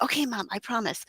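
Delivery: imitating a small boy's soft promise; hushed child. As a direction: voice a hushed little son. Voicing son told to be quiet.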